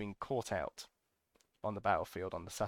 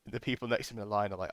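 normal talking voice

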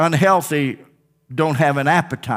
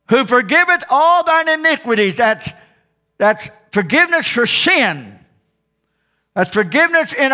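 preaching; 7 semitones higher than usual. Acted. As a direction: lift the verse to heaven. Scripture lifted in praise.

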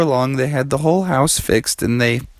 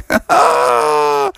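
high, drawn-out cartoon laugh; character laugh. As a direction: let out a long high-pitched whoop of laughter. Bear ends his boast.

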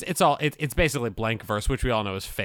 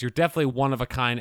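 rushed card quote; much faster than usual. Shown as a demonstration. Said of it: "and then makes a really, lame, enfeebled pun".

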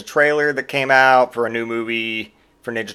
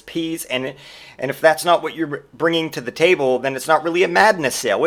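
normal talking voice

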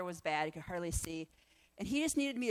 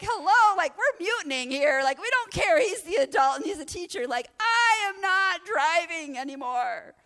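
protesting; 10 semitones higher, 11 dB louder than usual. Retold or imitated. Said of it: "The girls in the back started screaming".

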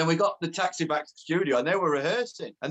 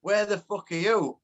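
lightly voiced caller, raised pitch, slowed down; a drawn-out, higher-pitched phone demand; Jimmy Bullard; 5 semitones higher, slower than usual. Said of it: "this voice on phone".

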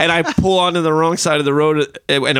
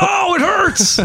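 mock pained kid wail; much slower than usual. Mocking scared kid.

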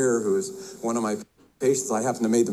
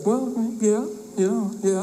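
higher-pitched, lightly voiced bar customer; hesitant joke customer; 8 semitones higher, slower than usual. Man at the bar answers the bartender, lightly acted and hesitant. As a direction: answer a warning in a raised, tentative voice.

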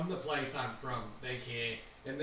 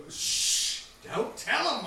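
urgent hush; 10 semitones higher, 6 dB louder, much slower than usual. Acted. NPC hushing a player aloud.

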